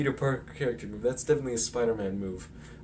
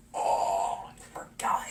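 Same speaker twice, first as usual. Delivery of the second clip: whispered, sheepish admission; mock-guilty whisper; 4 semitones lower, slower than usual. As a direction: breathe it out like a caught kid. Peter admitting guilt.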